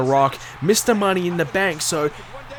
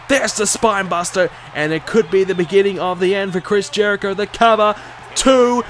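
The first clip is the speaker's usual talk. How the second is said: louder, higher near-fall call; wrestling commentator; 5 semitones higher, 5 dB louder than usual. Acted. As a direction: count it out ringside. Live match commentary.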